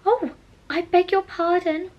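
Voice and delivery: high, loud, hurried sorry; anxious apology. Acted. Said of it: "cried Alice again".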